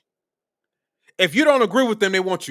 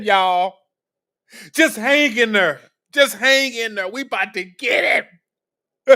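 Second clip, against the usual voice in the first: mock gleeful; 3 semitones higher than usual. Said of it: "That's what he really think, y'all".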